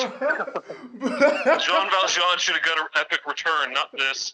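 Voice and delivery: hammed-up disgruntled heckle; heckling theatre fan. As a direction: complain from the cheap seats. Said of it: "who were just yelling at the".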